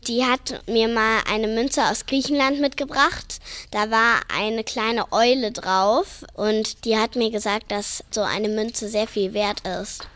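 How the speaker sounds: ordinary talk, nothing marked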